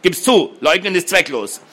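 a firm accuser insisting; stern demand. A narrator's reading. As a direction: corner him, no way out. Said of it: "fuhr Arnold ihn an".

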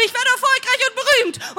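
high, exultant cry; called out. A narrator's reading. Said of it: "Ich rufe". (euphorisch ausgerufen)

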